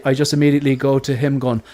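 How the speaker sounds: ordinary talk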